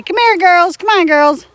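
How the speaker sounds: high, loud sing-song call; calling the chickens. Shown as a demonstration. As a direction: call them in cheerfully. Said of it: "as soon as I say".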